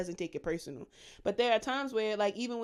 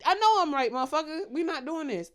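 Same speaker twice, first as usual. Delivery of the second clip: higher, louder burst, then easing; exasperated retort; 5 semitones higher, 4 dB louder than usual. Retold or imitated. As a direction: snap back in a mock argument. Acting out argument with father.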